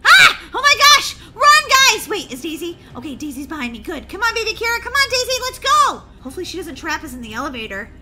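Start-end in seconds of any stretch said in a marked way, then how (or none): 0.0-2.1 very high, loud shrieks; scream, then order everyone to run; screaming panic. Acted. [name] fleeing the robot with sisters, screaming. 4.1-6.0 high, raised calls; urgent hurrying. Acted. [name] hurrying her sisters out, urgent calls.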